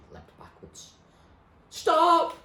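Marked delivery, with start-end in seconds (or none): 1.7-2.4 a piercing, very high yell; girl shrieks Stop at her attacker; shout. Acted. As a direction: scream it to stop him. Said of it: "she shouted".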